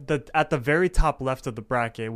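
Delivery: normal talking voice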